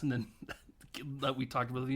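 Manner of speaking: ordinary delivery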